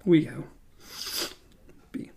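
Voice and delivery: ordinary delivery, nothing marked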